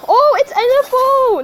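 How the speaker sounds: high, excited exclamation; delighted surprise. Real, not acted. Player spotting a welcome card, delighted surprise.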